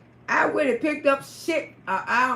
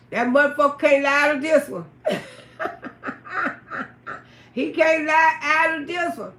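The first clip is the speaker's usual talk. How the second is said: ordinary delivery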